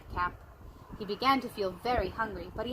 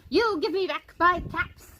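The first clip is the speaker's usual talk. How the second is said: high, louder scolding demand; scolding peddler; 7 semitones higher, 5 dB louder than usual. A narrator's reading. Peddler scolding the monkeys for his caps. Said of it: "shaking a finger at them".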